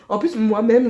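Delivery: laughing. Real, not acted. Laughs at her own knitting mistake.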